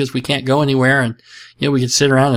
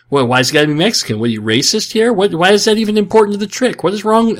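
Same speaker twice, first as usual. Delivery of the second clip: mock indignation; 7 semitones higher than usual. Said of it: "I was gonna say".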